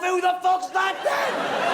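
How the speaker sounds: slow, disbelieving outcry with a laugh in it; appalled mock outcry. Comic talking to the crowd about a stranger in the mirror, appalled and laughing. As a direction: drag out the horror, half laughing. Imagining a shaved face.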